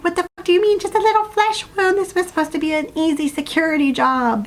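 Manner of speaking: upset, very high-pitched voice, easing slightly; screechy-high mock outrage over the phone; Natalia. Upset girlfriend on the phone to her injured partner, high-pitched outrage. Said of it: "the voice getting higher and higher 'cause Natalia's upset".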